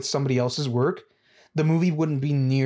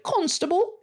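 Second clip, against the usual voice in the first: very high, hammed-up British cry; exaggerated British accent, pitched way up; mock British accent. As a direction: squeak it like a cartoon British bystander. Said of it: "people will be like".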